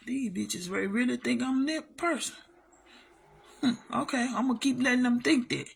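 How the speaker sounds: hushed scheming aside to yourself; soft, sly private thought; muttered inner thought. Retold or imitated. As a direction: say it under your breath like a private plan. Quoted inner thought, very quiet.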